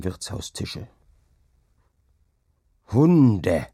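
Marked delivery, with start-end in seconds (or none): 2.9-3.7 loud, drawn-out curse; angry cry. A narrator's reading. Hauke cursing his remembered mockers, angry cry. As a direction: cry it out in anger, stretching the word. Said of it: "schrie er".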